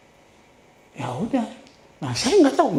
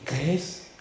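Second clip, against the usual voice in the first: soft inner voice; 6 dB quieter than usual. Retold or imitated. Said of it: "Dia bilang gini".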